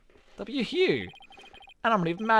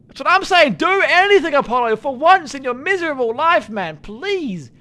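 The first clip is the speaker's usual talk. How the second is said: frantic higher shouts for help; panicked shouting; 9 semitones higher, 11 dB louder than usual. Real, not acted. Player screaming at the characters to help Athena, panicked yelling. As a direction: yell for anyone to step in, frantic.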